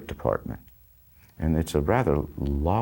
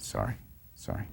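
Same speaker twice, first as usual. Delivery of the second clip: sheepish whispered sorry; 5 semitones higher, 11 dB quieter than usual. Retold or imitated. Retold apology after being scolded.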